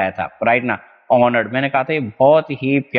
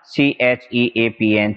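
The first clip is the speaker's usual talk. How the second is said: letter by letter, slow; slow classroom spelling; spelled out slowly; much slower than usual. Shown as a demonstration. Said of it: "चीपन".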